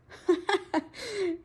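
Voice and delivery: a burst of high real laughter. Real, not acted. Laughing at the messy mud, high and real.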